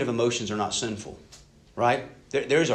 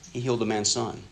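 ordinary delivery

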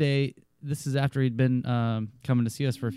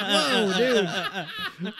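laughing surprise; 9 semitones higher than usual. Retold or imitated. Retold reaction to cop story.

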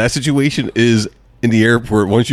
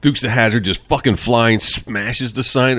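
normal talking voice

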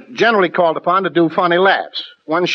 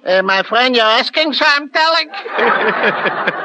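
higher, slow broad Yankee accent; 5 semitones higher, slower than usual. Shown as a demonstration. Actor answers the host in a thick Yankee accent. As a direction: lay the New England twang on thick. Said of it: "my Yankee accent".

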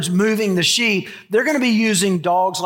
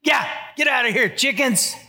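higher-pitched mock shooing of chickens; 5 semitones higher than usual. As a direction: shoo the hens away in short, raised-pitch bursts. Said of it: "That's how I talk to the chickens".

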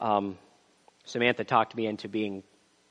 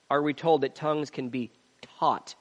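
ordinary talk, nothing marked

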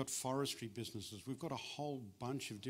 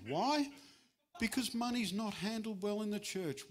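emphatic; 9 semitones higher, 5 dB louder than usual. Real, not acted. Preacher's rhetorical question.

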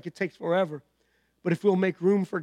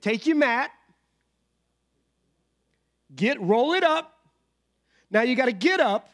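Jesus commanding; 6 semitones higher, 4 dB louder than usual. Retold or imitated. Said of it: "He said".

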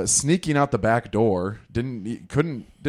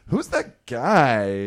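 very drawn-out, louder mock question with an inflection at the end; creeped-out girl's tone; 3 semitones higher, 5 dB louder, much slower than usual. Mimicking the girl who found him, exaggerated creepy tone. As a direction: stretch it out, imitating a creeped-out girl. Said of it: "the creepiness to the tone, you know, that little inflection on the end".

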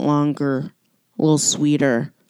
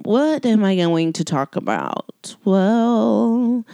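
usual speaking voice

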